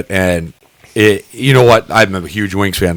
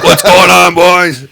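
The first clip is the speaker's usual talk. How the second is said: higher, louder call-out; mock hearty greeting; 8 semitones higher, 6 dB louder, slower than usual. Drunk teen hailing strangers at a tailgate, loud hearty greeting. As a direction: hail the boys across the lot.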